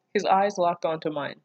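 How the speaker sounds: usual speaking voice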